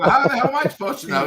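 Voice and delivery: high laughing disbelief; laughing protest. Retold or imitated. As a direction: laugh it off, loud and quick.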